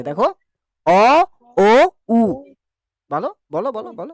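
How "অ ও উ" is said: অ, ও, উ each clipped off and separated, lips rounded; slow vowel drill with rounded lips; rounded vowel demo. Shown as a demonstration. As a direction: push the lips into a round snout for অ, ও, উ. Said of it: "দেখো মুখটা শুয়োরের পাড়া হয়ে যাচ্ছে".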